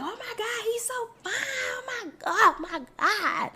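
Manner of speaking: imitated swooning fan, very high squeal; gushing fan. Fans praising the singer they adore, imitated gushing squeal. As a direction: mock-scream your adoration in a tiny high voice. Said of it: "words of affirmation".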